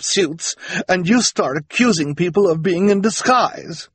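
high, loud haughty tirade; imperious socialite Esme. Acted. Esme threatens husband and children, imperious scolding.

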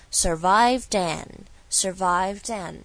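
"survived and" run together, slowly; "survived and" as one linked phrase, drawn out; linked pronunciation. Shown as a demonstration. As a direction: link "survived and" with the d carried into "and". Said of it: "它是怎么说的".

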